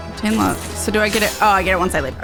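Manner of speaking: usual speaking voice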